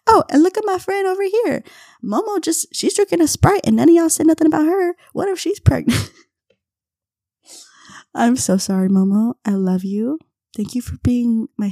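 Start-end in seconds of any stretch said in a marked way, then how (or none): 0.1-6.1 high, loud, rushed fake innocence; mock innocent deflection. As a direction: steer blame onto the friend, all wide-eyed. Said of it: "I went".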